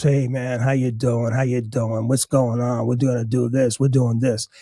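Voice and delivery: low, laid-back guy's greeting; imitated Italian guy. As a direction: run the greeting off low and casual. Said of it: "this guy is an Italian guy".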